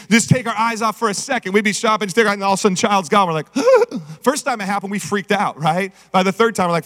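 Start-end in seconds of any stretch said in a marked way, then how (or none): 3.6-4.2 sharp high gasp of alarm; mock gasp. Parent realizing child is gone, sharp gasp.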